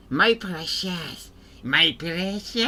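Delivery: raspy creature hissing my precious; slow creepy Gollum whisper. Pretend. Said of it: "hope the Golem voice doesn't".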